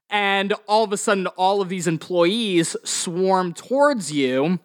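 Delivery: ordinary talk, nothing marked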